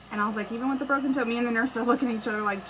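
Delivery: excited mom rushing the story. Retold or imitated. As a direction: race through it, amazed. Excited retelling of quick boy.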